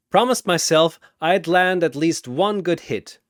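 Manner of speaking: proud grin. Acted. Exhausted Yuji boasting to Shazam, grinning. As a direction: toss it out like a hard-won victory.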